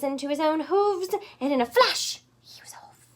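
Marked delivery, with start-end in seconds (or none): 2.4-3.1 soft dramatic whisper. Acted. Reader whispering the pig's getaway. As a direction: hush it as he escapes.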